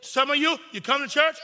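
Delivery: normal talking voice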